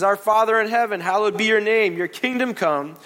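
loud, slowing prayer recital; solemn recitation. Acted. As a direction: recite it with reverence.

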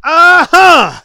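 high, loud "uh-huh"; eager Booger. A narrator's reading. Booger answering the judge's either-or, high and oblivious. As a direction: agree loudly at high pitch. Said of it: "said Booger".

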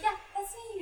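lightly imitated excitement, pitched high; a high, pleased character reply; excited girl. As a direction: act out a touch of girlish excitement. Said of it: "she gets all excited".

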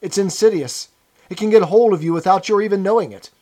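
preachy, insistent pronouncement; sententious. A narrator's reading. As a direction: warn them like a self-righteous lecturer. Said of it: "he said sententiously".

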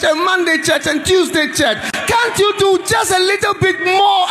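high, shouted revival preaching; preaching at full force. Acted. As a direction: pound each question home at the congregation.

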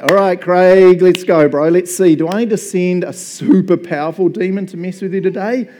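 loud, swaggering devil voice; cocky Satan. Retold or imitated. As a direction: play a smug bro devil sizing up his target. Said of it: "Satan's standing and he's like".

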